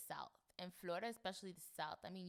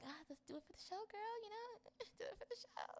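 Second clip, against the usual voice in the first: much higher, hyping herself up to keep watching; self-encouragement; 5 dB quieter than usual. Retold or imitated. She pep-talks herself about the show, much higher and laughing. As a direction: cheer yourself on, half laughing. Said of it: "But it's like".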